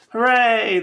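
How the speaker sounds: loud, high sarcastic hooray; jokey celebration; mock cheer. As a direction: cheer it ironically. Mock celebration after confession.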